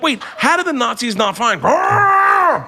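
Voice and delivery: slow, high, shouted bewilderment; baffled shout. Retold or imitated. Comic baffled at the Anne Frank house, slow high shout. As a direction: yell it out in total confusion. Shocked realization retold.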